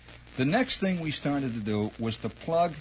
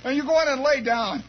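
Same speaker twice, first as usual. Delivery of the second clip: high mock scolding; exasperated mom, pitched up; scolding mother; 8 semitones higher than usual. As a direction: imitate a mother shaking her kid. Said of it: "she's shaking him".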